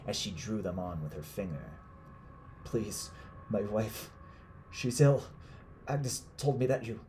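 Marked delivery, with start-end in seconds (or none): 2.6-7.0 higher, strained plea; pleading. Acted. Man begging his captor for his sick wife, pleading. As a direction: plead desperately, on the edge of breaking.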